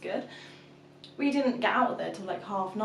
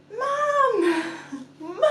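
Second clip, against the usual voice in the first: very high, loud drawn-out wail; imitated toddler scream; 12 semitones higher, 7 dB louder than usual. Toddler waking after mum sneaked out, loud imitated wail.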